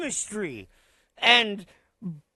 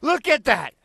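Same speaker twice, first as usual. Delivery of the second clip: ordinary delivery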